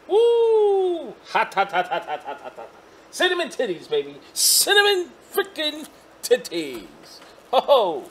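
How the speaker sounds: loud high hot-hot-hot yelps; excited shouting. Real, not acted. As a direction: yell from a scorching hit. Hot vape hit, shouting.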